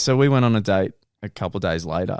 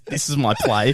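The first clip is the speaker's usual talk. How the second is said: ordinary talk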